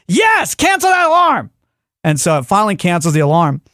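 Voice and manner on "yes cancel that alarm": high, slow, strained insistence; exasperated command. Retold or imitated. Man answering Alexa's needless question, exasperated command. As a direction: stretch each word high in exasperation.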